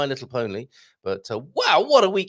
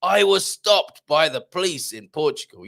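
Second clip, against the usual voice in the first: higher, mock-dramatic headline read; clickbait headline; 5 semitones higher, 4 dB louder than usual. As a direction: announce it as a sensational video title. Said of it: "can you imagine the clickbait titles".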